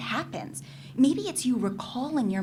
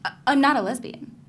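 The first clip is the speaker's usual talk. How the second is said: a sudden louder protest; quick, defensive, louder denial; defensive outburst; 3 semitones higher, 9 dB louder than usual. Acted. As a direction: blurt the denial out, flustered. Denying a suggested attraction.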